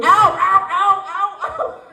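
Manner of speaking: high, squeaky, mock-hurt little-girl "ow"s; mimicking a little girl's cries. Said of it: "And she's going".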